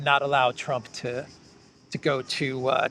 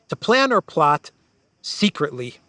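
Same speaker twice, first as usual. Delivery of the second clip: normal talking voice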